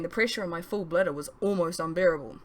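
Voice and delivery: usual speaking voice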